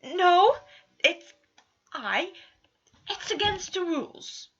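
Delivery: high, loud flustered stammer; splutter, caught out, then cling to the rules. Acted. Prince flustered when accused of liking being nursed, high and loud. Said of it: "flustered cough at the accusation".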